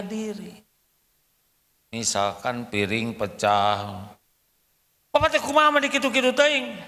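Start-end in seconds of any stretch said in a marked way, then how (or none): 5.1-6.8 high, fast nagging wife scolding her husband; shrill-free high rapid complaint. Acted. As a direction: snap at him like an annoyed wife over a broken plate. Wife scolds husband over broken plate.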